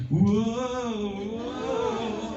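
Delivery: high sung whoa of a slippery soap; sing-song soap voice. Retold or imitated. Host imitating Slippery Soap sliding past, high and sung. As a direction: slide the whoa up like a soap gliding. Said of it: "he'd be like".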